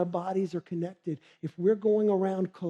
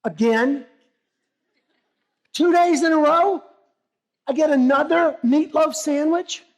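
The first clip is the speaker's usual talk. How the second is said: much higher and louder, whining in disbelief; exasperated complaint; 9 semitones higher, 10 dB louder than usual. Acted. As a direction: complain loudly about the same lunch. Fed-up worker over his lunch.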